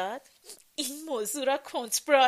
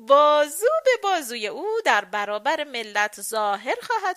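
loud, high, theatrical taunting; amused mockery; 5 semitones higher, 8 dB louder than usual. A narrator's reading. Lady mocking the queen as his dear mama, amused. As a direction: gush sarcastically, louder and higher. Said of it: "با خنده گفت".

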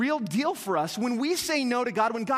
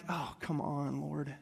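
groaning protest; 7 semitones lower, 9 dB quieter, slower than usual. Retold or imitated. Reluctant young pastor answers God.

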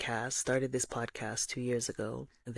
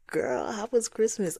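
higher, louder excited question; excited gossip; 8 semitones higher, 4 dB louder than usual. Acted. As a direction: ask it like juicy gossip. Question repeated, sassy.

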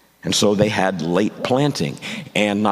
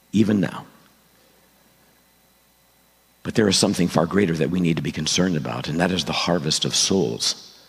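prayer. Acted. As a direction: pray earnestly to God. Pastor leads a prayer.

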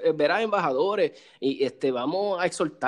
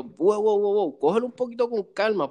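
higher, easy-going whoa, settle down; calming whoa; 5 semitones higher than usual. Retold or imitated. Calming a rushed beginner shooter.

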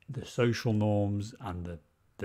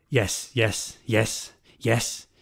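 repeated words building higher and louder; imitating unravelling man; 6 semitones higher, 5 dB louder, slower than usual. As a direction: rattle it off like a man coming unhinged. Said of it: "we're fucking watching this guy unravel".

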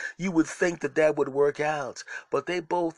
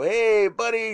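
sheepish hey buddy; 8 semitones higher, 6 dB louder, slower than usual. Retold or imitated. Caught, greeting rival owner sheepishly.

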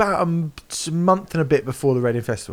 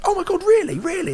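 much higher, imitating excited disbelief; animated; 3 dB louder than usual. Drummer replying to a fan who saw him at Glastonbury, animated. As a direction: mimic the drummer's delighted surprise, pitch right up. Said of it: "he got really animated".

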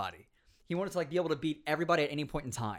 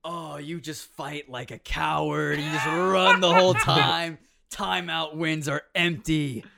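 slow, louder taunting edgelord; edgy anime persona; 9 dB louder, slower than usual. Retold or imitated. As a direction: taunt him like a smug anime villain. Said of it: "going overboard with this anime, like, edgy persona".

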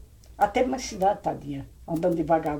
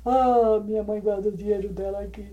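weeping; 3 semitones higher, 3 dB louder than usual. Retold or imitated. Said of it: "Chorando, chorando, sem parar".

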